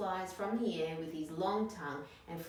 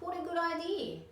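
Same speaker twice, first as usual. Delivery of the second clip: much higher, louder delight; delighted dingo; 12 semitones higher, 4 dB louder than usual. Acted. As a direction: gush over the idea.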